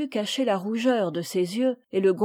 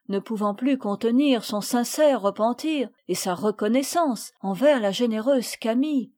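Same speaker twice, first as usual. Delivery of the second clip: ordinary delivery, nothing marked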